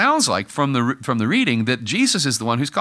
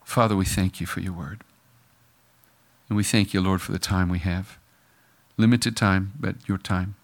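closing prayer; 3 semitones lower than usual. Acted. Pastor leading the congregation in closing prayer, hushed.

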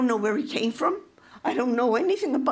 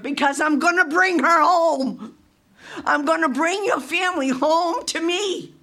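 loud, impassioned divine promise; fervent proclamation; 4 semitones higher, 5 dB louder than usual. Retold or imitated. The Lord promising Debbie her family's return, emphatic. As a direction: proclaim it with force. Retelling God's promise at full force.

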